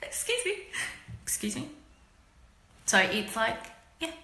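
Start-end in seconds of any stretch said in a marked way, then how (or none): 0.0-0.5 a bright polite excuse me, higher up; sweet courteous excuse me. Shown as a demonstration. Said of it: "we do speak like that". 1.2-1.7 a rude, put-out excuse me. Shown as a demonstration. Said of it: "we do speak like that".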